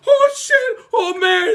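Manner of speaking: very high squealed alarm; mock panic. Acts driver panicking after crash.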